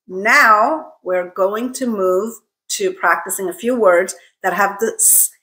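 ordinary delivery

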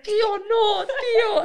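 high, loud mock-panicked cry to an uncle; laughing, hammed-up kid protest; pleading kid's yell. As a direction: call out like a kid begging, half laughing. Kids beg uncle not to switch off.

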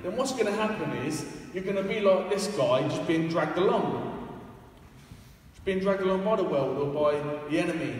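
usual speaking voice

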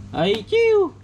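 high squeaky whoosh for the cast; mouth sound effect. Acted. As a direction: zip the line out with a high "chiu". Toy fishing, catch sound. (tiếng vút cao)

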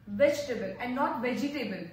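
vegetable as "vej-ta-bl", not "vej-i-tay-bl"; no stress on the E in vegetable; correct vegetable. Shown as a demonstration. Teacher contrasts vegetable "vej-ta-bl" with "vej-i-tay-bl". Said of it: "don't have to put stress on E".

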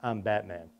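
mock serious Batman line from a little boy. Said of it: "says it just so serious".

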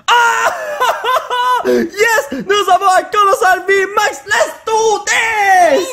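high, laughing shout of joy; excited scream. Real, not acted. Thrilled shout over a rare card. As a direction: yell it out, laughing with delight.

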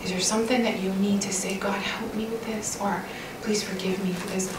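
soft, breathy hush, barely voiced; hushed whisper. Real, not acted. Speaker whispering prompts during silent prayer, hushed. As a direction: drop to a whisper so they can pray. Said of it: "I'm just gonna quiet down here".

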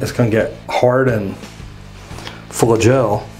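ordinary delivery

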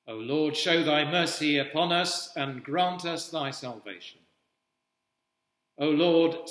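usual speaking voice

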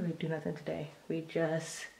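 soft whisper to the pets. Real, not acted. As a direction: hush them outside quietly. To pets, hushed.

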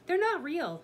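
high, loud assertion; insistent. A narrator's reading. Annie insists soldiers are fake.

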